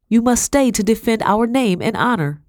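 high, urgent insistence; insistent plea. A narrator's reading. Wife urging her husband not to leave, insistent. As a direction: press him hard to stay.

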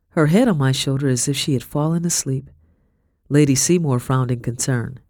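ordinary delivery, nothing marked

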